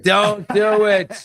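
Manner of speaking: high, slow, stretched-out warning; drawn-out mock warning. Co-host warning him off a risky joke, high and slow. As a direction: stretch it out like a cartoon alarm.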